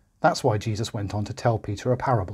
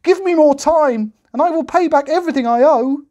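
pleading cry; 7 dB louder than usual. A narrator's reading. The servant begging the king for more time. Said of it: "he cried".

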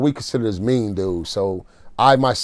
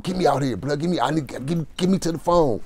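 higher, hushed retold pleading; urgent plea; 3 semitones higher, 5 dB quieter than usual. Begging [name] to get him to the phone, urgent retold plea. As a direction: plead in a rush without raising volume.

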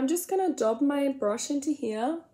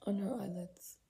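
unvoiced muttered correction; whisper; 3 semitones lower, 12 dB quieter than usual. Real, not acted. Correcting her own word in a whisper. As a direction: whisper the fix to yourself.

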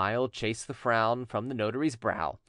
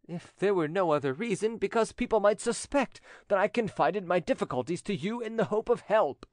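lightly voiced notary, higher and earnest; scrupulous notary; 8 semitones higher than usual. Notary fears what people might suspect, earnest protest. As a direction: explain your scruple earnestly. Notary gives reason for refusing.

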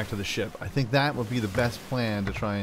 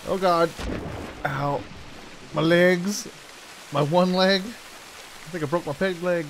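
pained yelp; 7 semitones higher, slower than usual. Pretend. Pirate character falls, hurts leg.

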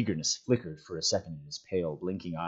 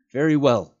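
louder, higher reply out loud; raised, declarative reply; answering aloud; 7 semitones higher, 9 dB louder than usual. A narrator's reading. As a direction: speak up in answer to an unseen voice. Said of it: "he answered aloud".

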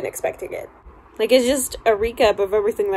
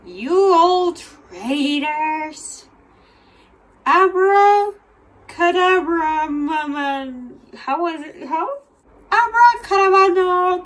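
Voldemort impression; 6 semitones higher than usual. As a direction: ham up a silly dark lord casting spells. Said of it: "That's how I picture Voldemort".

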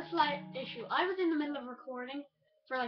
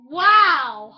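startled wow; 6 semitones higher, 19 dB louder than usual. Real, not acted. Startled by spider then laughs.

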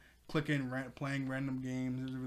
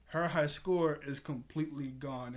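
ordinary talk, nothing marked

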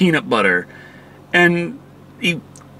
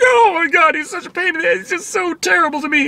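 much higher, whining complaint; mock whiny granddaughter; 13 semitones higher than usual. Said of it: "And she's like".

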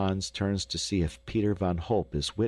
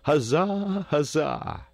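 high, loud crowd hurrah; celebrating shout; jubilant cheer; 8 semitones higher, 4 dB louder, slower than usual. A narrator's reading. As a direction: cheer loudly with pitch way up.

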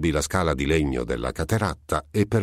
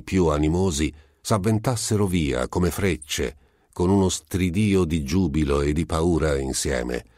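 plain reading voice

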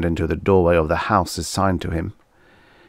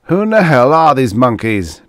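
loud, high-pitched outraged question; indignant demand; 7 semitones higher, 8 dB louder than usual. A narrator's reading. Man sizing up strange natives, indignant demand. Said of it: "demanded the man".

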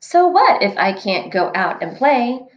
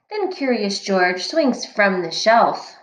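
usual speaking voice

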